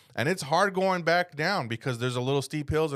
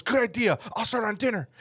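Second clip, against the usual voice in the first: higher imitated girlfriend voice; oblivious eagerness; 8 semitones higher than usual. Girlfriend told to ride to camp, eager high reply. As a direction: chirp it back, pitched up, missing the emergency.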